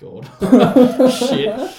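high, loud laughing groan; laughing dismay. Retold or imitated. Hearing he just got out of jail, laughing dismay. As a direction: crack up in disbelief. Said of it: "I'm like".

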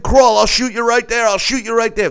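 imitating the cop yelling a threat; threatening shout. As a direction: scream the threat like a trigger-happy cop. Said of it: "I said".

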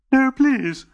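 high, loud desperate plea; Dodo crying out. A narrator's reading. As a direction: beg in panic. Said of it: "he cried".

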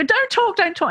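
put-on high, pleading child voice begging parents to stop; frightened child. Parents talking, child begs them in a high scared voice. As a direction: squeak it out like a scared little kid. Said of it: "they might go".

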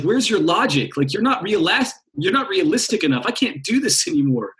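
lightly hammed logical complainer; exasperated mind. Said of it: "And then the mind's like".

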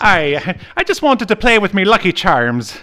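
loud, high, hammed-up Irish; mock Irish accent. Host shows his co-host an Irish accent, hammed-up Irish. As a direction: do a cartoon leprechaun Irish accent. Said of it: "I can't do an Irish accent".

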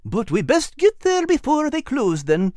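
high, eager little animal voice; Al's high voice. Acted. Al urging Nigel toward the bank, high and eager. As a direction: voice Al bright and high. Al's voice, narrated children's story.

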